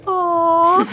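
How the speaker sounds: high, pitying aw; mock sympathy. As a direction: coo over the dog. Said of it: "then they're like".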